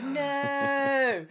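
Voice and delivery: high, hammed-up cry of loss; mock anguished no. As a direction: parody an underplayed moment of grief. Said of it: "and she's like".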